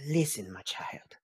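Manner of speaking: low, whispered, after a sigh; mother's weary address. Acted. Mom answering her child on the phone, low whisper. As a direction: sigh it out as a hushed reproach. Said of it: "she goes".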